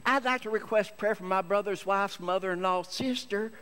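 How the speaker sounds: chanting prayer request in a put-on church voice; long-winded testifier. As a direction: drone it out like a rambling church lady. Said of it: "when called on to testify".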